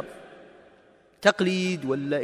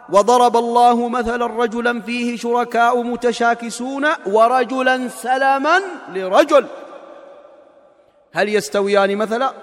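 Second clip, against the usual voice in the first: slow, louder measured recitation; Quran recitation; 4 semitones higher, 7 dB louder, slower than usual. Acted. Preacher reciting the parable verse to the congregation, slow and measured. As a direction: recite the verse slowly and fully.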